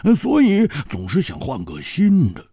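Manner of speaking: high, animated character voice; high-pitched, wry protest; wry, bitter amusement. A narrator's reading. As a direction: hem and haw, then argue it high and quick. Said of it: "苦笑着说".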